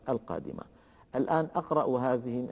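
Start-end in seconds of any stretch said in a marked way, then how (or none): none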